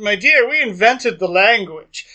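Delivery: imitating a haughty British put-down, loud; Maggie Smith. Drag queen playing the actress answers a rival, posh put-down. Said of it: "as he's portraying Maggie Smith".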